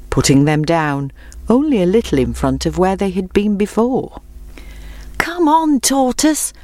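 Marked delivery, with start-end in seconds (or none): 5.2-6.5 high-pitched worried urging; anxious call. A narrator's reading. Friends to the plodding tortoise, anxious call. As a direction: urge on a lagging friend, anxiously. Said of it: "called his friends anxiously".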